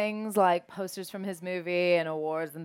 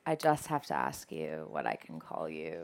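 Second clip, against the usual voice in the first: low, soft, nervous retelling of the question; timid low ask; 9 dB quieter than usual. Retold or imitated. Guest retelling asking De Niro his name, low and timid. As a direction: shrink down, ask shyly.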